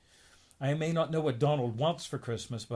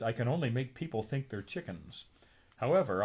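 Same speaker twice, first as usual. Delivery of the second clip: ordinary delivery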